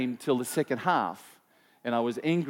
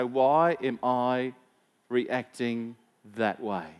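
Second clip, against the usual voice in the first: very slow, reflective inner question; slow self-questioning; 3 semitones lower, much slower than usual. Retold or imitated. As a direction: let each word hang as you question yourself. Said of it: "give ourselves a space to say".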